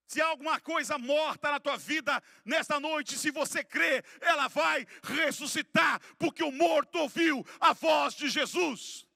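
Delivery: loud, high-pitched preacher's cry; full-force preaching. Real, not acted. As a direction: preach it at full volume, hammering each phrase.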